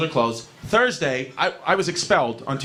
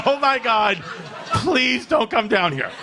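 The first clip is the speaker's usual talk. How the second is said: pleading; 6 semitones higher, slower than usual. Retold or imitated. Begging dad not to come.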